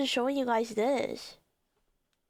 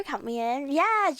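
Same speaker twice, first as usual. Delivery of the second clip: ordinary talk, nothing marked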